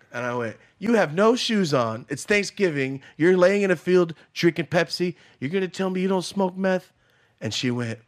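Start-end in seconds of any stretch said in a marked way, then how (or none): 0.8-6.9 loud, raised-pitch scolding; retold scolding. Confronting the woman in the field, loud and scolding. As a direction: call her out loudly.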